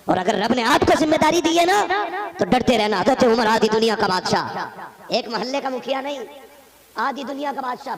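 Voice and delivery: loud sermon voice; loud pulpit oratory; fiery preaching. Acted. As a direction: warn the listeners from the pulpit. Preaching at full force.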